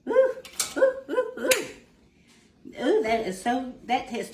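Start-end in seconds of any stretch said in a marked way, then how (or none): 0.1-1.5 squealing high ooh of delight; high-pitched happy oohs. Real, not acted. Tasting the food, delighted.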